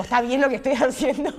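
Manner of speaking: giggling self-conscious question; laughing. Retold or imitated. Nervous question while working in kitchen.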